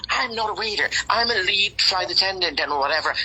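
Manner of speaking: he bristles at being called waiter; indignant attendant quote. Retold or imitated. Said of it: "And he said".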